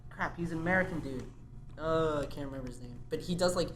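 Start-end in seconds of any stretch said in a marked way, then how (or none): none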